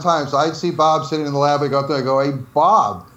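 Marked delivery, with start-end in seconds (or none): 2.2-3.1 big, high-swinging New York greeting; New York accent. Acted. Storyteller hails coworker Bob in the lab, New York accent. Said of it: "I'd start doing my New York accent".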